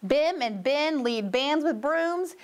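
plain reading voice

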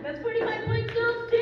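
high, loud announcer call; game-show score announcement. Real, not acted. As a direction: proclaim the score to the crowd. Game host announcing score to team.

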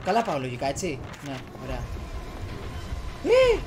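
normal talking voice

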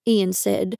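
ordinary delivery

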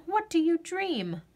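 ordinary delivery

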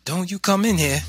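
high, stern motherly warning. A narrator's reading. Mother barring son from the house, stern warning. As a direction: forbid it sharply, pitch well up. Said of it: "my mother warned me".

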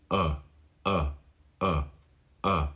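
"a" said as "uh"; soft repeated uh for "a"; back relaxed vowel. Shown as a demonstration. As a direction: say "a" slack and further back. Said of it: "this is the back of the mouth".

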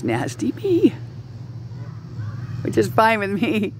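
high little pleading voice; mock pleading. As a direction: plead in a high, playful squeak. Mock pleading to be left alone.

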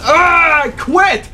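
high, loud cry of giving up; frustrated yell. Real, not acted. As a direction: shout it like you're throwing the controller. Frustrated gamer yelling at game.

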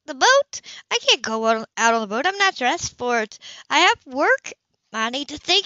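high, loud, rising alarm at the boat; woman objecting loudly to being taken sailing; flustered protest. A narrator's reading. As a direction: protest in a rush, caught off guard. Heroine panics about the boat.

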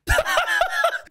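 very high cackling laugh; gleeful laughter. Real, not acted. Prankster after hearing friend scream, high gleeful laughter. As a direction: burst out laughing at the victim.

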